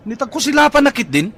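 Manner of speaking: heated challenge to the driver; angry taunt. Acted. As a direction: taunt the old driver hotly. Passenger picks fight with driver.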